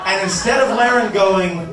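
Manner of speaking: normal talking voice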